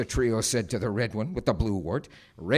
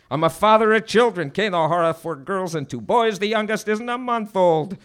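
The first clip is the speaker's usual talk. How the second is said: high, loud, desperate pleading; frantic father's plea; pleading victim; 9 semitones higher, 8 dB louder than usual. Acted. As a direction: beg for mercy as a family man. Victim pleads for his life.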